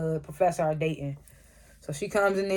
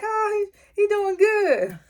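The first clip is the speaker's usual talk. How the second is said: very high, drawn-out squeal of approval; mock crowd cheer; slower than usual. Professor in the crowd approving of the candidate, high mock cheer. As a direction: squeal it high like an adoring onlooker.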